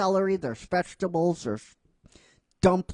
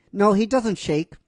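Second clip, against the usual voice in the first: usual speaking voice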